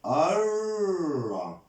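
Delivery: الرجل with a long trilled R: arrr-ra-ju-lu; doubled R. Shown as a demonstration. Teacher showing the sun letter in الرجل, R held long. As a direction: hold the R in الرجل, then slow syllables.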